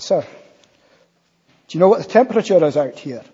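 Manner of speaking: a policeman's Scottish Borders accent, lightly attempted; preacher imitating a Scottish Borders policeman at the window. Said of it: "Sorry, it's a Border's accent. I can't do it very well".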